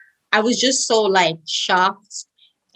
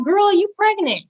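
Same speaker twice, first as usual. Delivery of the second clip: higher-pitched imitation of a friend; retold news-breaking friend; 11 semitones higher than usual. Friend laughingly tells her she's pregnant, high retold voice.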